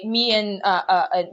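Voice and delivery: usual speaking voice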